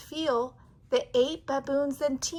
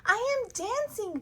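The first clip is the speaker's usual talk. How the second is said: high, gleeful exclaiming; joyful cry; 9 semitones higher than usual. A narrator's reading. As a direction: shout it with delight. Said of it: "Gerald cried".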